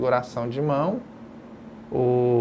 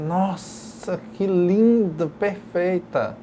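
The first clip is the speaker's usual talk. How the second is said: drawn-out, marvelling praise; admiring exclamation; 3 semitones higher, much slower than usual. Acted. Teacher playing someone admiring a passer-by, marvelling. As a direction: gush in wonder at someone lovely. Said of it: "a pessoa tá passando e fala assim".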